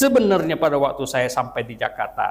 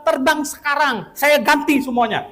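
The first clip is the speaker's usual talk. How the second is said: retold angry rebuke, much higher and a bit louder; 11 semitones higher, 4 dB louder than usual. Friend scolding the preacher for missing his sermon, angry. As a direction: scold him as a let-down friend. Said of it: "Dia marah sekali".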